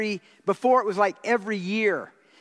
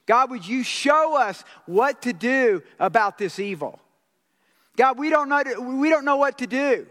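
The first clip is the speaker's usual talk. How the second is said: raised, higher-pitched pleading prayer; crying out to God; 5 semitones higher than usual. Acted. A nation's leaders praying about evil, crying out. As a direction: plead upward to the Lord, urgently. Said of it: "lament and cry out to God".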